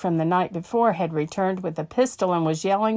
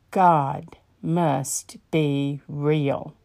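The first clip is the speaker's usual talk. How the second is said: awed realization; 4 semitones lower, much slower than usual. Acted. Awed conclusion after the story.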